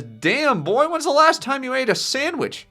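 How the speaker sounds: high, loud mocking bully voice; jeering taunt. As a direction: jeer it way up high. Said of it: "The boys jeer at him".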